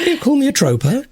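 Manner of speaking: high, incredulous mock outrage. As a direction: play at being insulted. Mock offence at word trope.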